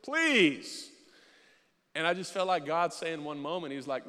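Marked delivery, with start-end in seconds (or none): 0.0-0.9 high, loud scoffing "Please". Retold or imitated. Pastor mocking a person on TV, scoffing. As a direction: toss it off with a sneer. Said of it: "I'm just scoffing at this person".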